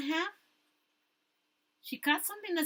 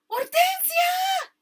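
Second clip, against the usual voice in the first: much higher, louder, slow scream of a name; panicked scream; 11 dB louder, much slower than usual. Acted. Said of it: "and she screamed".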